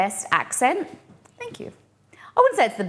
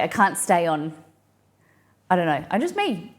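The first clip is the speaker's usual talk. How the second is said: normal talking voice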